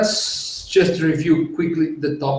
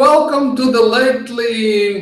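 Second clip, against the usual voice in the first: excited greeting; 10 semitones higher, 6 dB louder than usual. Real, not acted. Podcast host opening greeting.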